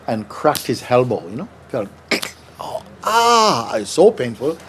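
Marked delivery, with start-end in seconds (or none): 2.6-3.7 sudden high yelp of pain; pained cry. Retold or imitated. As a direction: cry out as the king hurts his elbow. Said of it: "and he's screaming".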